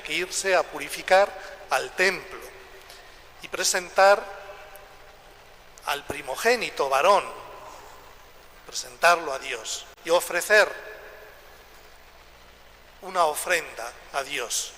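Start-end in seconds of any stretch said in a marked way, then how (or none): none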